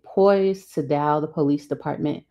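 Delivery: usual speaking voice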